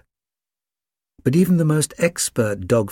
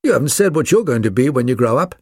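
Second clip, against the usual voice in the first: loud, raised-pitch puppy demand; insistent puppy voice, pitched up; barked question; 5 semitones higher, 5 dB louder, faster than usual. A narrator's reading. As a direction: press the question loudly. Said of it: "barked the others".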